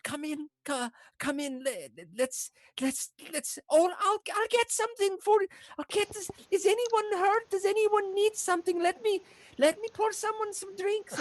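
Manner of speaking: very high, stammering hospitality; flustered halfling host. Acted. Halfling host offering drinks to rescuers, flustered. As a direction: fuss eagerly over the guests.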